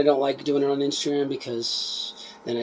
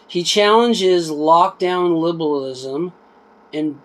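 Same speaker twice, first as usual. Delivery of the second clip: reading a blurb aloud, a bit livelier than chat; book read aloud; 5 semitones higher, 7 dB louder, slower than usual. Acted. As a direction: read the back cover out to viewers. Reads back cover aloud.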